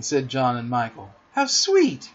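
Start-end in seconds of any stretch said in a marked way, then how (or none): 1.3-2.1 high-pitched cry of delight; admiring cry. A narrator's reading. Wendy watches Peter fly, admiring cry. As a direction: coo it admiringly, well up in pitch. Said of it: "cried Wendy".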